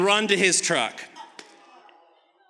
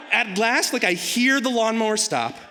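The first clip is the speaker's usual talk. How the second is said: ordinary talk, nothing marked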